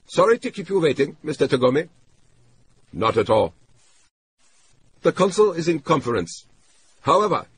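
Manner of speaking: smooth stalling underling; accented functionary. A narrator's reading. Clerk stalls Tagomi on the phone, accented. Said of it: "the functionary's voice once again".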